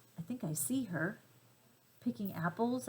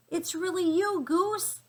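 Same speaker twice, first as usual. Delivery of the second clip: much higher and louder, calling out in delight; joyful shout; 10 semitones higher, 6 dB louder than usual. A narrator's reading. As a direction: shout it out, thrilled to see her again. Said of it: "I shout".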